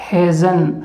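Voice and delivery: hazen said as "hez-un"; hasn't clipped to hazen; saying hasn't. Shown as a demonstration. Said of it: "इसे क्या उच्चारण करेंगे आप?".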